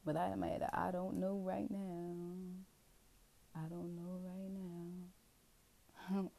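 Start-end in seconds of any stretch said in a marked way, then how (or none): 3.6-5.1 slow, softer, fading; quiet uncertain murmur; soft trailing. Real, not acted. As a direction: let it drift off unsure.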